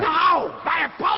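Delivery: king's enraged roar at Kent; furious oath. Acted. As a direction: shout the oath high and loud. Lear swearing in fury.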